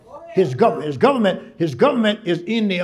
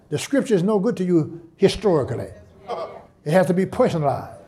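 ordinary talk

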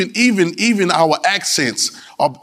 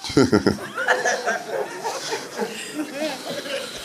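long high, soft laugh; 7 semitones higher, 9 dB quieter than usual. Real, not acted. Preacher after joke about talking bad, laughing. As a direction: let a laugh run on, trailing.

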